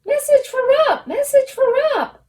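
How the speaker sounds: very high, loud gnome squeal. Acted. Gnome running up to Rump, high loud squeal. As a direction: shriek it like an excited little gnome.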